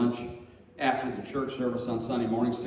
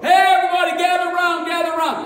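high, loud call to a crowd; booming street-preacher holler; crowd-gathering shout; 13 dB louder than usual. Retold or imitated. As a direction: summon everyone around you.

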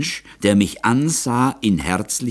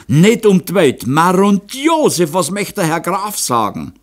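high, loud horrified refusal; aghast stammer; 11 semitones higher, 5 dB louder than usual. A narrator's reading. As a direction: exclaim in dread of the master. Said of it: "stotterte Moser". (entsetzter, hoher Ausruf)

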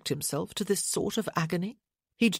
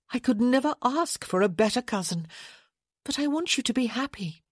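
lightly voiced lady; 7 semitones higher than usual. A narrator's reading. Francesca voiced by narrator.

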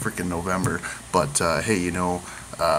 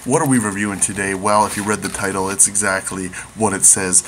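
ordinary talk

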